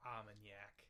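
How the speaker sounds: put-on Boston accent.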